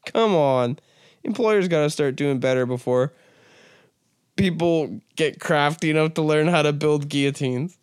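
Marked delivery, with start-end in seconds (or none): none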